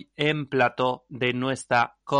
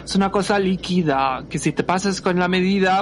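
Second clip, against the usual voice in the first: panicked wife; 8 semitones higher, 5 dB louder, faster than usual. Acted.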